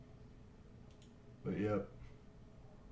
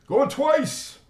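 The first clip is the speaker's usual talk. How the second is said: high, loud call of going twice; auctioneer shout; 9 dB louder than usual. Acted. Auctioneer to the stream viewers, calling the final bid in a high, loud shout. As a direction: belt it out like an auctioneer.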